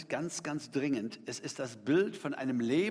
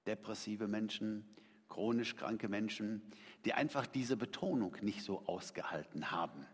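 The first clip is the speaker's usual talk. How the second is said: normal talking voice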